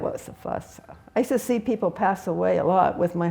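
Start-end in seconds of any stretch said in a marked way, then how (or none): 0.0-0.8 quiet, nearly whispered; soft, under the breath. Real, not acted. Teacher repeating the master's words to herself, soft. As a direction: let it slip out softly. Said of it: "I like that".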